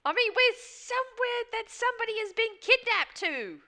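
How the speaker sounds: high, disbelieving pushback; sarcastic, raising pitch in disbelief; incredulous. Real, not acted.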